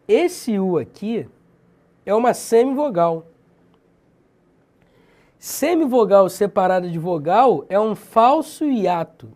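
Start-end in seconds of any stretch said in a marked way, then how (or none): none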